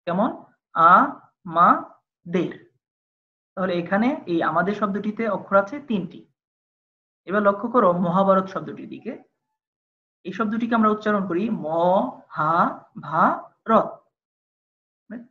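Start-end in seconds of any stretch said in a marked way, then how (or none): 0.7-2.5 আ, মা, দের said as three separate beats; syllable by syllable. Shown as a demonstration. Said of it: "তিনটা প্রয়াসে উচ্চারণ করি". 12.4-13.7 হা, ভা, র each said alone with pauses between; syllable by syllable. Shown as a demonstration. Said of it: "চারটি প্রয়াসে".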